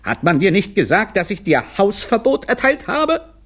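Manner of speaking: high, rising angry rebuke; irate boss, pitch climbing; indignant outburst. Acted. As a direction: scold in mounting outrage. Director angrily confronts banned employee.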